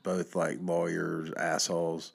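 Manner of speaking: usual speaking voice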